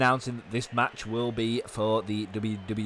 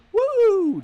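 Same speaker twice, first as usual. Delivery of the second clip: shrill high-pitched woo shout; high falsetto woo; 11 dB louder than usual. Pretend. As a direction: belt a high woo like the entrance theme. Entrance woo.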